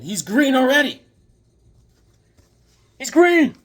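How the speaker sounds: high, loud outburst; excited exclamation. Real, not acted. Explaining why he became Mantis Man, loud high exclamation. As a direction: blurt it high and loud as if it were obvious. Joking about Mantis Man's colour.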